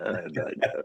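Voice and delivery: a soft, breathy aside to yourself; whispered. Real, not acted. Cohost repeating his answer, whispering. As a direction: whisper it under your breath.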